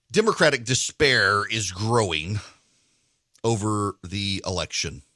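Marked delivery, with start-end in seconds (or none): none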